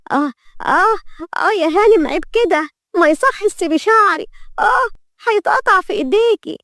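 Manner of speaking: very high-pitched pained yelps and pleading; crying out in pain. A narrator's reading. Maid begging mistress to release her hair, pained. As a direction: yelp and plead as your hair is pulled. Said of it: "وصرخها بألم".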